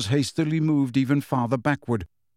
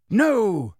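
a sharp, louder no; 7 semitones higher, 4 dB louder than usual. A narrator's reading. Dumbledore stops Trelawney, sharp. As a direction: cut her off firmly. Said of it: "said Dumbledore sharply".

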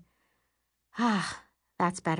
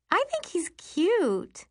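put-on higher, slow dreamy girl voice; dreamy crush; 12 semitones higher, slower than usual. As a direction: sigh it out like a crush. Carly swooning over a boy.

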